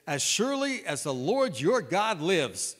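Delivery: slow and loud, bitter; bursting out. Acted. Widow bursting out at Elijah, loud and bitter. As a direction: let the oath burst out of her. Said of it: "she just lets everything burst out".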